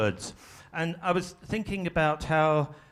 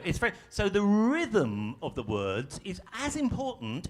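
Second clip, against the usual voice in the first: normal talking voice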